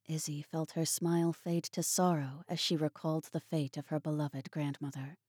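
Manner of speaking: ordinary delivery, nothing marked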